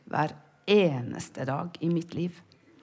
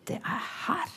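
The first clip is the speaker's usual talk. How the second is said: soft; 3 semitones higher, 11 dB quieter than usual. Acted. Pointing to the heart.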